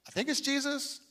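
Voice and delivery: quiet, doubtful, trailing off; mock a hesitant believer; unsure mumble. Mocking a doubter, unsure mumble. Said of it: "I'm not going like".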